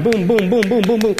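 rapid string of booms; imitated repeated booms. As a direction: fire off booms like a burst of touchdowns. Imitating repeated touchdowns in quick succession.

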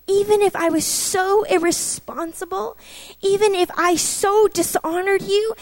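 high-pitched, incredulous questioning; astonished, each question rising at the end; stunned disbelief. Retold or imitated. Asking God if He would really provide, incredulous. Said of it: "it was like that moment of, What?".

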